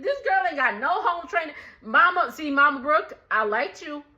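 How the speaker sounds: ordinary talk, nothing marked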